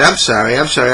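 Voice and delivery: normal talking voice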